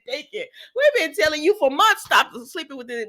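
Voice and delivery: high, loud talk breaking out of a laugh; laughingly scold, pitch way up; amused exasperation. Real, not acted. Viewer tells Deacon off for sleeping with Sheila, amused exasperation.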